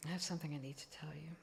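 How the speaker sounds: hushed, quick whisper through tears; tearful whisper. Retold or imitated. Mother confessing to her daughter over lunch, tearful whisper. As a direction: whisper the confession, close to crying. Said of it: "tears are coming down my face".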